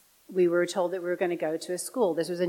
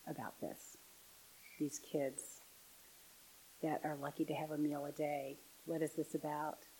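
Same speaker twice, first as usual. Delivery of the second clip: hushed and moved; 2 semitones lower, 15 dB quieter than usual. Real, not acted. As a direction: drop to a hush, phrase by phrase. Hushed, moved retelling of her question.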